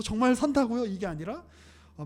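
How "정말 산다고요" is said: higher and louder, delighted disbelief; mock joyful gasp of hope; overjoyed. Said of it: "마르다가 너무 기뻐하면서". (기뻐서 들뜬 목소리로 되묻기)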